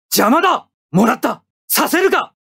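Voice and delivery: sharp high combat barks; battle cries. Acted. Pilot Trowa firing on the enemy, sharp high battle barks. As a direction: bark each line like firing at an enemy.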